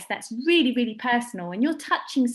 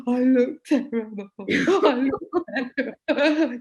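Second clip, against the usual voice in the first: mock crying; 3 dB louder, slower than usual. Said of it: "she was like".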